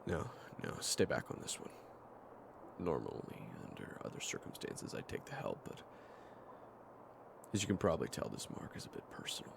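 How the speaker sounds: low, soft, near-whispered voice; hushed, gentle refusal. Acted. Fighter asks companion to stay back, hushed and low. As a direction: turn them down softly, almost under the breath. Quietly declining companion's help.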